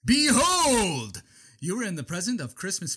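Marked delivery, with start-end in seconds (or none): 0.0-1.2 grand announcement. Acted.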